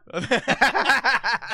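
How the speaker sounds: high-pitched burst of laughter; big high real laugh; loud laugh. Real, not acted. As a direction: crack up loudly at the joke. Joking about owning gossip account.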